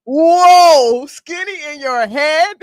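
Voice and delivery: loud, high, drawn-out disbelief; astonished. Real, not acted. Host reacting to her skinny-in-the-head claim, astonished. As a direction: cry out in stunned amusement, stretching it.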